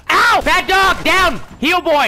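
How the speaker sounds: high, loud scolding; urgent mock-trainer shouts; yelled dog commands. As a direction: order an attacking dog off. Attacked by dogs, shouting commands.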